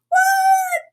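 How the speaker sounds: hammed-up astonished what; surprised shout. Real, not acted. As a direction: shriek it in playful disbelief. Mock amazement at huge prize.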